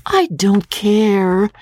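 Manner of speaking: put-on high, drawn-out girlish protest; slow, high, stubborn wail; petulant cry. A narrator's reading. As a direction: cry it out like a foot-stamping daughter. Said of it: "cried Magnolia, and stamped her foot".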